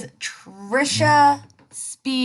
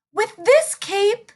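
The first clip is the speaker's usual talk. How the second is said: put-on much higher, preening little girl; vain girl voice; 10 semitones higher, 3 dB louder than usual. Little Red admiring herself in puddle, high preening. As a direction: admire yourself out loud.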